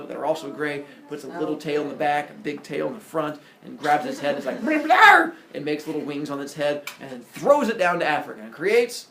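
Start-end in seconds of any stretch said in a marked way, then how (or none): 4.6-5.3 very loud, high "blah"; comic effect noise. Retold or imitated. Epimetheus grabbing the elephant's head, noisy effort. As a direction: blurt a huge high yanking noise. Imitates pulling out elephant ears.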